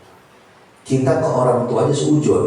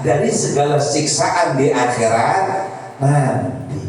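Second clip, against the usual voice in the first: preaching; 6 semitones higher than usual. Acted. Preacher explaining the fruits of weeping to the congregation, preaching. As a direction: hold the pitch and draw out the endings.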